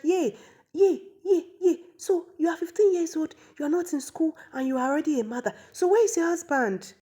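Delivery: high, dismayed exclamations into questioning; hammed-up high-pitched shock; shocked wailing. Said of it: "the queen was so shocked".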